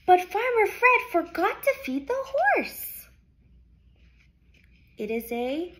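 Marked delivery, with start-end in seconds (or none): 0.1-3.1 high excited exclamation; calling voice. Shown as a demonstration. Teacher modelling an exclamation for kids. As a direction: call it out with surprise. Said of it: "So we say".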